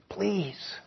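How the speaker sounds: whispered, broken "Please"; cracking whisper plea. Acted. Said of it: "the leper cried, his voice cracking".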